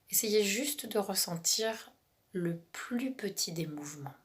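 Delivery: calm relaxation guide, half whispered; guided meditation. Acted.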